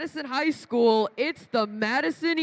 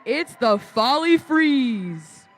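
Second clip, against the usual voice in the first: fighting game announcer; 4 dB louder, slower than usual. Acted. Hyped team intro at robotics match.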